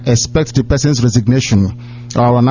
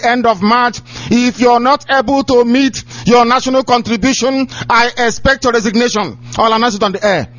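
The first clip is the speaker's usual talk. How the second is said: raised, forceful scolding sermon; preaching at full force; 7 semitones higher than usual. Acted. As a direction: thunder at them from the pulpit. Director berating coordinators on air.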